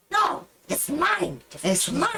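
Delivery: put-on high, slow shrieking goblin girl. Goblin girl fighting the minotaur over the satchel, high shriek. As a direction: squeal it like a greedy little goblin. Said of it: "She's like".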